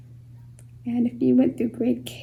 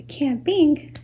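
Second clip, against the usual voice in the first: CAMPING as two distinct pulses; two impulses; 3 semitones higher, 3 dB louder than usual. Shown as a demonstration. Teacher showing syllables to a class, CAMPING in two pulses. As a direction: sound CAMPING in two beats, camp and ing. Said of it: "it's two impulses".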